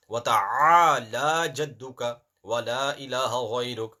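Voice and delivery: measured Quranic recitation. Acted. Teacher reciting the opening prayer to students. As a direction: recite it steadily.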